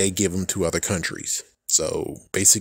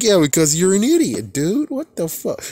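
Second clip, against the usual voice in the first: high, louder mock put-down; mocking scold; 11 semitones higher, 4 dB louder than usual. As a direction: jeer at a friend who got it wrong. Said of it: "It's like".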